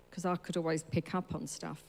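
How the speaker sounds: usual speaking voice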